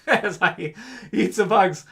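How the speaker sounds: slow, through a laugh. Real, not acted. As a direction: chuckle it out, amused at yourself. Joking about eating bugs.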